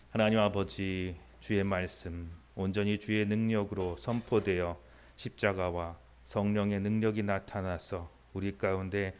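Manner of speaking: slow, reverent opening prayer. Acted. Pastor praying before the sermon, slow and reverent. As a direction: pray it, unhurried and earnest.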